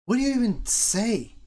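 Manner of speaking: high, loud mimicry of his wife; mock wife voice. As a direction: mimic the wife's high incredulous tone. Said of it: "she was like".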